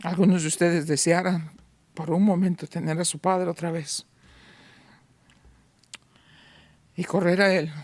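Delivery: quiet heartfelt appeal; soft tender preaching. Acted. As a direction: draw them close gently. Soft intimate sermon passage.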